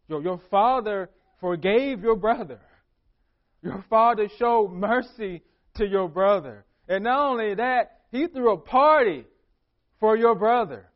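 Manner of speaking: rising preacher fervor; preaching emphasis. Acted. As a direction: drive it home to the congregation. Preacher driving home the point.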